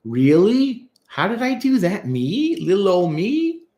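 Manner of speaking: hammed-up false modesty, pitch climbing high; fake-humble, flattered disbelief; mock flattered therapist. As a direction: act astonished at praise, with rising questions. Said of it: "A lot of therapists get into that".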